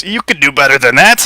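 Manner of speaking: goading shout at an opponent; taunting shout. Acted. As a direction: call it out as a taunt.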